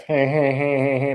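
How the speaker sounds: loud, high mock laugh, "hey" over and over; imitated obnoxious laugh. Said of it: "she got that laugh".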